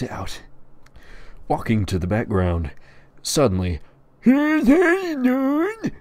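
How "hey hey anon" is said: very high, loud call; calling out in character. Acted. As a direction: holler it across the yard. Tyler calls out to Anon.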